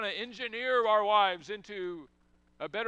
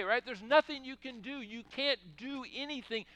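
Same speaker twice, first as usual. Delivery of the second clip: ordinary delivery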